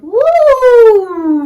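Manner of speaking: high, loud whooping cheer; excited woo. Real, not acted. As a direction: whoop like a fan. Cheer for Ennard.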